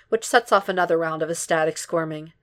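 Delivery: plain reading voice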